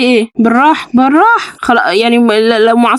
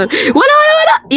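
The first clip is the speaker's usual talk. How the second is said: shouting; slower than usual. Real, not acted. Yelling at the baby.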